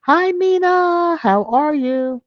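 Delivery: high sing-song greeting. Real, not acted. Greeting a viewer in chat.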